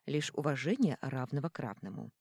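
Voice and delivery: usual speaking voice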